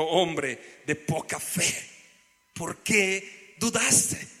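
slow, measured mild reproach; gentle reproach. Acted. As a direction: draw the question out, as if lightly voicing Jesus. Jesus voiced reproaching sinking Peter.

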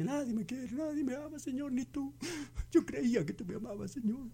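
whiny self-pity, pitched high and soft; self-pitying woman. Retold or imitated. Pastor mimicking a woman shut in her house, whiny. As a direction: whimper it like a lonely woman. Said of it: "se va a encerrar a su casa y dice".

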